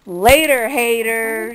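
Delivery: loud send-off to the haters; defiant sign-off. Real, not acted. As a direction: call it out to dismiss the critics.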